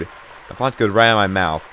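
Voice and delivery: ordinary delivery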